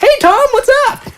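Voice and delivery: put-on squealed high child's greeting; excited little boy. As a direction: greet Tom like a thrilled kid. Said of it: "the little boy with them".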